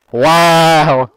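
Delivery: surprised wow. Real, not acted. Shocked loud exclaim.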